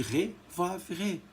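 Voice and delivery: usual speaking voice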